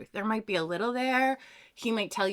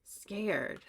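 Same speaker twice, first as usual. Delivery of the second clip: ordinary talk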